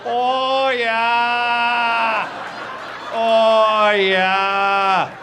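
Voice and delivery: loud, high, drawn-out Wisconsin moaning; hammed-up Wisconsin bedroom cries; Wisconsin accent. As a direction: belt long Wisconsin vowels in mock passion. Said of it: "Nobody sounds weirder".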